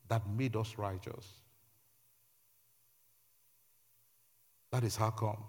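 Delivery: quiet, measured exposition; hushed preaching. Real, not acted. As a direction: bring the preaching right down, phrase by phrase.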